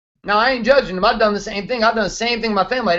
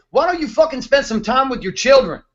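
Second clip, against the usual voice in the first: ordinary talk, nothing marked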